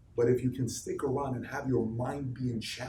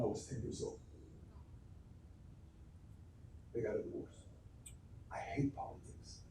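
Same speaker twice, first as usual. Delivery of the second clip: near-whispered, sinking low and soft; hushed; 3 semitones lower, 17 dB quieter than usual. Real, not acted. Speaker recalling parents' divorce to the audience, hushed. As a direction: let it drop to a hushed confession. Painful childhood memory, voice drops.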